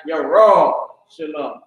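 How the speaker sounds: YOUR RAW SHALOM with a long stressed RAW; slow down and lean on RAW, then let SHALOM fall; accented Jerusalem. Shown as a demonstration. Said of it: "which is Jerusalem".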